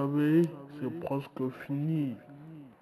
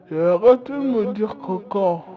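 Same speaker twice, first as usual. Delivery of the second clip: loud scolding telling-off; 3 semitones higher, 9 dB louder than usual. Real, not acted. Scolding the children.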